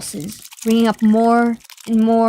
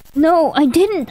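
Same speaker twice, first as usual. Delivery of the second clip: desperate denial; 6 semitones higher than usual. Acted. Denying to accusing ghost.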